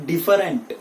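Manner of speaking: 'different' as three syllables, di-fa-rent; wrong pronunciation. Shown as a demonstration. Teacher showing how we used to say it, wrong pronunciation. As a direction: sound the middle e in 'different'. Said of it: "but that is wrong pronunciation".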